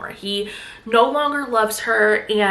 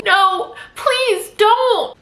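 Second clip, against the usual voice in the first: higher, desperate no, please don't; frightened pleading, pitched well up; panicked plea; 11 semitones higher, 4 dB louder, much slower than usual. Retold or imitated. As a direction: beg her not to, voice jumping up. Millie pleads with Nina.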